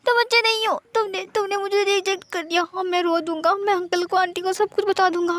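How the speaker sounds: put-on high, pouty childlike whimper; high pitched tearful complaint; whiny crying. A narrator's reading. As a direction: sulk and whine like a child about to cry. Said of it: "म्यान म्यान ने कहा".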